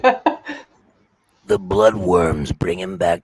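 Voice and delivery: slow, deep trailer narrator; in a world where, movie trailer style; movie announcer voice. Pretend. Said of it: "No more movie announcer voice for me".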